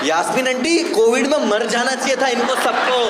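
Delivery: high, loud hammed-up curse; mock outburst. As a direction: blurt it out as a joke.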